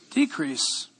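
soft drop. Acted. Sermon quote landing softly.

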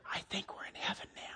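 breathless, barely audible whisper; awestruck little boy. Retold or imitated. Boy seeing clouds outside the window, awed whisper. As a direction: imitate a child whispering in wonder.

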